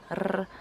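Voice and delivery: a trilled "r" on its own; rolled R. Shown as a demonstration. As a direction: roll the "r" on the tongue tip. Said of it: "will roll their R's".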